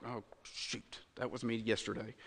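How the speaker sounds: very low, hushed aside; sheepish admission. Acted. Preacher voices a listener realizing it was them, sheepish and hushed. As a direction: confess it under your breath.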